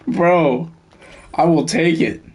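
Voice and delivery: excited gamer reacting to a great pull; thrilled. Real, not acted. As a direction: grin through it, still riding the excitement.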